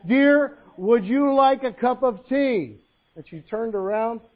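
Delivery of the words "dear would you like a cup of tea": slow, high, very loud asking; loud insistent question. Acted. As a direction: spell it out for someone hard of hearing. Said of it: "gets right behind the chair".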